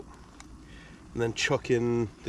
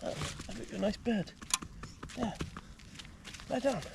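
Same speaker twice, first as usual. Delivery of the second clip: soothing; 9 semitones higher, 11 dB quieter than usual. Real, not acted. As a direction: gently coax the dog to lie down. Settling dog to bed.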